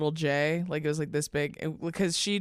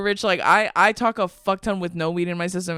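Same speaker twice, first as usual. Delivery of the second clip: ordinary talk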